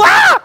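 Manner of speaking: high, loud shriek of fright; startled scream. Acted. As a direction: disciples startled by a voice behind them, shrieking in fright. Disciples startled by Jesus appearing.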